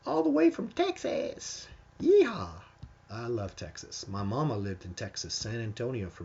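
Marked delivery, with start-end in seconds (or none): 0.0-2.5 very high, hammed-up rodeo holler; playful Texan whoop. Host cheers a guest coming from Texas, playful whoop. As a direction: whoop it up high and slow like a cowboy.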